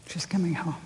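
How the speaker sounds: very soft angelic word; hushed announcement. Retold or imitated. Angel speaking of a girl's return, hushed. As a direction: say it softly, almost to yourself.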